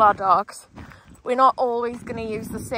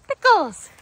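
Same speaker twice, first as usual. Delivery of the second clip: higher, louder recall call; calling out to dog; 9 semitones higher, 8 dB louder than usual. Real, not acted. Trainer recalls her dog, calling out. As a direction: call the dog's name out loud.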